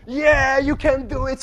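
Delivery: imitating a roaring crowd; crowd cheering.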